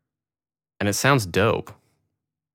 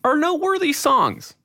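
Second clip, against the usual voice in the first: much higher, silly squeaky voice; high mock voice. Correcting himself, he squeaks up in mock pitch. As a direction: pipe it up high as a joke.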